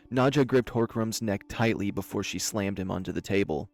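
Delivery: plain reading voice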